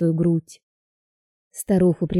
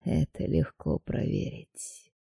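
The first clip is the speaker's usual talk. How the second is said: contemptuous; 10 dB quieter, slower than usual. A narrator's reading. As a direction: sneer it quietly. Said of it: "посмотрел на неё презрительно".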